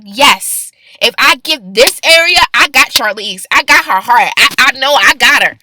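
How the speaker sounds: loud, triumphant divine voice; God claiming her heart, loud. Retold or imitated. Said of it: "he was like".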